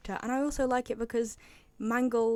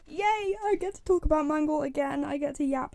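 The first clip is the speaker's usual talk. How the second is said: high, louder burst of delight; excited; 5 semitones higher, 5 dB louder than usual. Real, not acted. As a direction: cheer with glee at a favourite topic. Delighted to reach favourite character.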